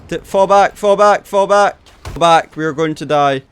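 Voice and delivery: high, loud panicked calls; frantic retreat order. Real, not acted. Frantic retreat under fire.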